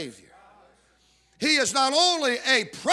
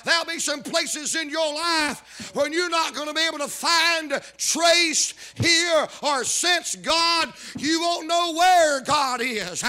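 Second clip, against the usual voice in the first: fervent preaching; 5 semitones higher than usual. Real, not acted. Preacher warning believers of times they cannot sense God, fervent preaching. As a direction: drive each word home to the congregation. Preacher builds to full force.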